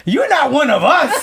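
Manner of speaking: high, loud laughing quote. Retold or imitated. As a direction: laugh out the accusation. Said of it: "Now my own people look at me like".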